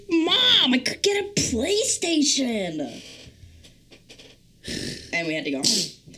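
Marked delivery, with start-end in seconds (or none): 0.1-3.0 put-on high, slow childish whine; whiny kid pleading. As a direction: beg like a kid. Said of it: "And I was like".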